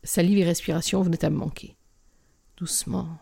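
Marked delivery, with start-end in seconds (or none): 2.6-3.2 hushed, soothing plea; soft pleading. A narrator's reading. Narrator begs a growling dog to calm, softly pleading. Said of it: "Ma demande avait des tours de supplication".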